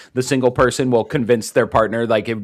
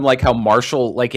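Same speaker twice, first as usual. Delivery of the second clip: usual speaking voice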